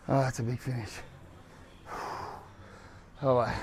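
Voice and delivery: normal talking voice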